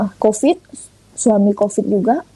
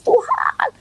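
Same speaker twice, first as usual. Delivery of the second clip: retold tearful cry; 13 semitones higher than usual. Crying to God from severe stomach pain, tearful cry. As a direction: imitate crying out in pain, voice pitched high. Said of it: "saya sampai teriak, saya nangis".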